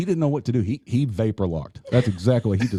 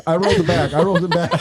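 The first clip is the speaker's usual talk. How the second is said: louder, higher, through laughter; laughing; 9 semitones higher, 6 dB louder, faster than usual. Real, not acted. Joking with the other hosts, loud laughing delivery. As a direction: crow it as the laugh takes over. Joking over earlier gag.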